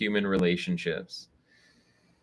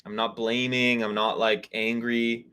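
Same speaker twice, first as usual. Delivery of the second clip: ordinary delivery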